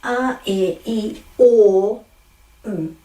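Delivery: a, e, i, o, u as pure vowels in a row; measured vowel drill; Māori vowels. Shown as a demonstration. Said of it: "remember the a".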